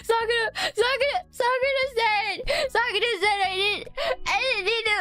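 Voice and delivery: high, whiny mock sobbing with stumbling repeats; tearful self-blame. Driver confessing to friend about the skipped check, tearful. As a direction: blubber it like a hammed-up confession.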